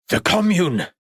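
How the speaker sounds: high, startled outburst; old artist suddenly crying out a name; sudden exclamation. A narrator's reading. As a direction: blurt it out as a memory hits. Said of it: "exclaimed Thurmain".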